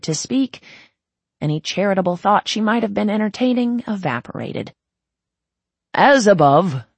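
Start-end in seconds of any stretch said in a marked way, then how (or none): 5.9-6.8 loud ceremonial call to the crowd. Acted. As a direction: proclaim it to the whole hall. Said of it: "the High Princept called out".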